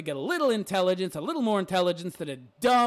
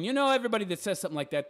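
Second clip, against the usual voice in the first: usual speaking voice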